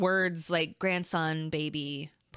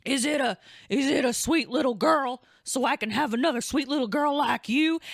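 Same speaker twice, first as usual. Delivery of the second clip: tender dad; 6 semitones higher, 3 dB louder than usual. Retold or imitated. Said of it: "then I just started, like, bawling".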